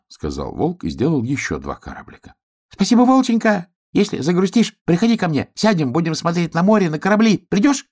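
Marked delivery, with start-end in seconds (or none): 2.7-7.8 very high little hedgehog voice, eager; grateful hedgehog, excited. Acted. Hedgehog invites wolf to see sea, high and grateful.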